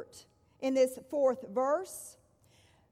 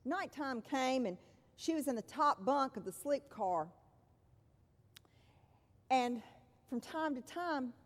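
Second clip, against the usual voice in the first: normal talking voice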